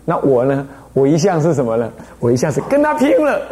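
ordinary delivery

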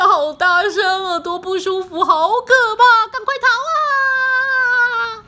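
high, loud cries of alarm; hammed-up panic. A narrator's reading. Friends fleeing the princess's loud crying, in mock panic.

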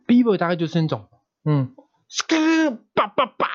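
high scratch and beat noises; b-boy sounds. Shown as a demonstration. Showing the b-boy style with vocal scratch noises. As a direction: imitate b-boy scratches with skrrr and 叭. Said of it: "用声音上面有什么差别".

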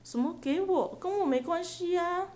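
high, innocent feigned confusion; playing dumb. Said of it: "姐姐就开始装傻". (装傻的高音无辜语气)

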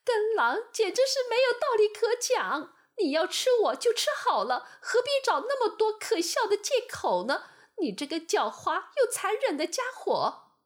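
high, loud desperate lamb shout; desperate lamb cry. Acted. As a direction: cry out in despair at the wolf. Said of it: "小羊绝望地喊道". (小羊绝望地喊)

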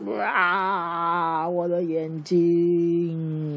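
眼睛被刺的恐龙，极慢地拖长哀嚎; 像怪兽一样慢慢哀叫出痛苦; 受伤的迅猛龙惨叫. Acted. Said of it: "迅猛龙就这样子". (迅猛龙捂着眼睛慢吞吞地哀嚎)

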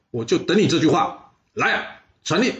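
high, ringing command; commanding order. Acted. The ruler names his marshal and commands the attack, high and ringing. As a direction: proclaim it to the whole court.

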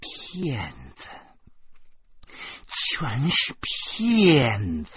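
very slow, stretched condemnation; drawn-out accusation. Acted. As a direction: start held back, then swell with each long word. Enraged woman repeats her accusation. (一字一顿、拖长音地控诉)

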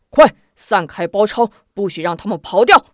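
shouted order. Acted. General orders troops.

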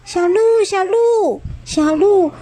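高声急切地喊名字; 像孩子一样拼命大喊; 拼命呼喊. Acted. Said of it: "四只甲龙宝宝也拼命呼喊". (孩子般高声呼喊)

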